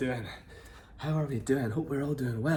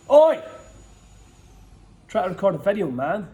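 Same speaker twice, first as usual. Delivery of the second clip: loud annoyed yell; shouting; 6 semitones higher, 13 dB louder than usual. Real, not acted. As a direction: shout over at the neighbour. Yelling at neighbour cutting bushes.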